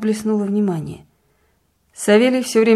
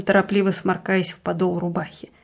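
usual speaking voice

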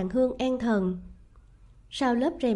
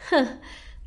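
short breathy scornful laugh; icy scoff; cold laugh. A narrator's reading. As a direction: let out a cold huff of laughter. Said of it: "Thái hậu bật cười lạnh".

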